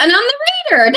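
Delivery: high, crying voice that cracks at the end; voice breaking. Real, not acted. Hurt reader to her viewers, voice breaking into tears. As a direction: let the tears break through the words.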